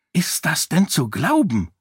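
a hint of furious outrage. A narrator's reading. Sarene to her friends, lightly voiced fury. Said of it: "fragte Sarene zornentbrannt".

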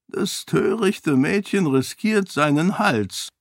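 high, slow mock quotation; amused quoting. A narrator's reading. Roial repeating the Seon's message to Sarene, amused. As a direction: quote the warning slowly in a lifted voice, amused. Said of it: "sagte Roial leise lachend".

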